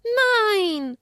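high, loud cry of Nein; high child shout. Acted. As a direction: yell Nein in protest. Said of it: "schrie Esther".

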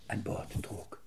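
whispered in awe; hushed whisper. A narrator's reading. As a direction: breathe it out reverently. Awe at king aboard. (ehrfürchtig geflüstert)

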